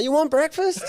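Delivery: put-on high, loud Thai-accented offer of breakfast; Johnny's accent. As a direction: call out cheerfully like the host.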